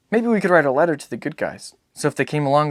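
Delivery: put-on a child's hopeful suggestion; hopeful boy. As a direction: son eager with an idea. Boy's hopeful idea.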